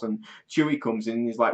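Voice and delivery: ordinary talk, nothing marked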